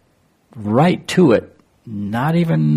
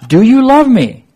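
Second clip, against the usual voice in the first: earnest pleading question; 12 semitones higher, 9 dB louder than usual. Acted. Jesus asks the third time.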